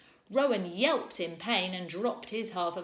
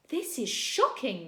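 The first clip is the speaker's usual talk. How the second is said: higher, louder, slow; shocked scolding; 5 semitones higher, 5 dB louder than usual. A narrator's reading. Teacher scolding Rowan, shocked reproach. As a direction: scold with outrage.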